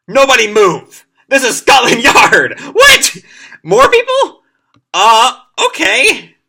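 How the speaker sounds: loud, high barked police order falling into confusion; hammed-up cop shouting at the room. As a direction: bellow the raid then fumble. Scotland Yard bursting in.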